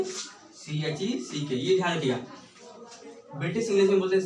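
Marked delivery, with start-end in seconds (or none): 0.0-1.5 "सी एच ई सी के" spelt very slowly and softer; letter by letter, slow and soft; slow spelling. Shown as a demonstration. Teacher to class, spelling the American word slowly. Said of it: "क्या बोलेंगे".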